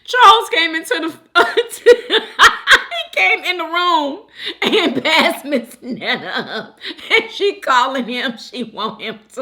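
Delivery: helpless laughter breaking up the words; high, loud start dissolving into tearful laughing; talking through laughter. Real, not acted. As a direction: try to retell it while cracking up. Retells clip while cracking up.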